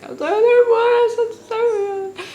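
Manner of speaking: high, loud, slow sobbing wail; crying. Retold or imitated. Mother reliving her tears in the delivery room, crying wail. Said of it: "I was tears".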